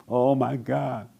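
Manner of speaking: ordinary talk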